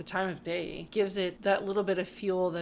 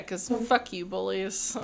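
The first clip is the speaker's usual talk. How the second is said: ordinary delivery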